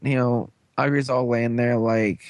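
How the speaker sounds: normal talking voice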